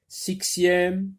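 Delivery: sixième with a sharp ks, siksième; wrong s sound. Shown as a demonstration. Teacher testing a wrong pronunciation on her class. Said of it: "Est-ce qu'on va dire".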